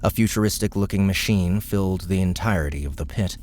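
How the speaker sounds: usual speaking voice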